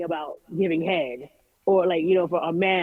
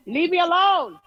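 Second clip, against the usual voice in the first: raised voice, jumping high and loud; exasperated yell; 10 semitones higher, 4 dB louder than usual. Real, not acted. Pestered parent interrupting an interview, exasperated yell. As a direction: shout them off, drawing out the last word.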